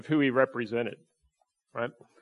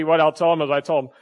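usual speaking voice

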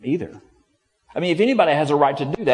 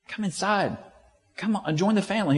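pleading father; 8 semitones higher than usual. Retold or imitated. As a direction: coax him gently inside. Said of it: "He goes".

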